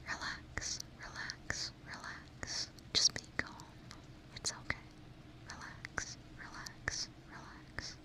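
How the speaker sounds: very soft, far-off whispered relax; ASMR trigger words, whispered very softly; close-up trigger words. Pretend. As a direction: breathe each relax right into the mic. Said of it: "Some close-up trigger words?".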